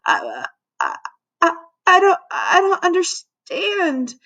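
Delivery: put-on high, tearful kid stammer. A narrator's reading. Humiliated kid facing lunch monitor, stammering. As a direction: stammer helplessly, voice climbing into a whimper. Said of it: "red-faced and stammering by this time".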